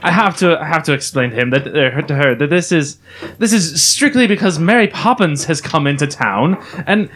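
put-on British-accented pundit; British expert. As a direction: explain it as a British expert, stammering at first. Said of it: "we have our British expert [name] here".

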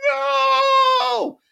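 very high, loud, drawn-out wail; hammed-up high shriek of protest; mock horrified cry. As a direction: cry out in exaggerated dread. Horrified reaction to the insult.

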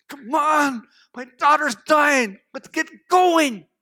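desperate urging. Acted. Jairus begs Jesus as his daughter is dying, desperately urgent. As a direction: plead to hurry, stretching the last words.

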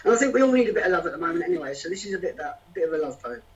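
ordinary talk

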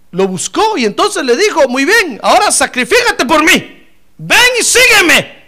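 predicar fuerte, con tono alto; prédica enérgica. Acted. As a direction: subir hasta una orden gritada y alargada. (proclamar como predicador encendido)

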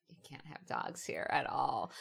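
low, slow and quiet pronouncement; mock stern ban. Line producer forbidding dogs on set, retold low and stern. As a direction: hand down the rule as a lightly imitated official.

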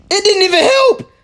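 high, loud panicked wailing; overacted death scene. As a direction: shriek it out like doomed. Panicked game shouting.

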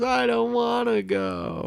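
high, slow, whiny wail; mock crying. Imitating SpongeBob refusing to leave for Atlantis, whiny mock crying. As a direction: wail it like a bratty child. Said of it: "he starts to cry and goes".

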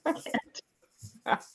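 ordinary talk

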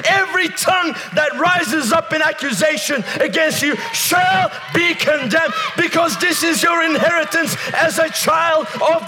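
high-pitched, shouted revival preaching; fervent shouted proclamation, pitched high; full-force preaching. Real, not acted. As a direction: preach at full cry, phrase by phrase.

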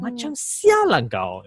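high, sing-song lilt on "Macam sial lah kau"; sing-song insult. Retold or imitated. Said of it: "that sing-song kind of intonation".

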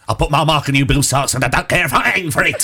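loud stage-musical character voice; put-on voice, projected loudly; best Nancy. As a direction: launch into a bold character impression. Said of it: "that's my best Nancy".

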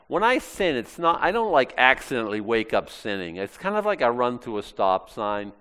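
ordinary talk, nothing marked

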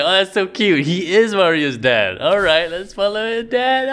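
high, excited gush over something cute. Retold or imitated. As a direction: squeal it out like it's adorable. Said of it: "I'm like".

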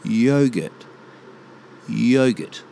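YOGURT as YOH-gurt; long O in Yogurt; proper pronunciation. Shown as a demonstration. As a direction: open the first syllable of Yogurt to "yo". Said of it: "the first part of the word".

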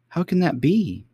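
put-on high bewildered girl voice; cartoon princess. A narrator's reading.

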